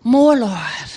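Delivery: low, relentless mock prayer; imitated praying minister. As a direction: mimic a minister calmly insisting. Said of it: "But they keep saying".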